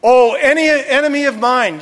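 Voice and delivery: high, loud shocked exclamation; hammed-up startled outcry; acted shock. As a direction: cry out as a king caught off guard. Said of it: "was shocked, and he said".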